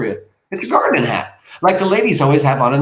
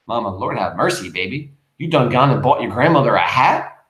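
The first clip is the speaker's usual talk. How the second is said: ordinary talk, nothing marked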